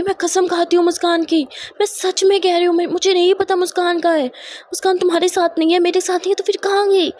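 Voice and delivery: high, insistent swearing of innocence; agitated protest. Acted. Mother-in-law swears she doesn't know where Muskan is, agitated. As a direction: protest urgently, pitched well up throughout.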